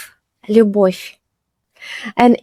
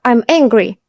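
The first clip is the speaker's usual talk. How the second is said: ordinary talk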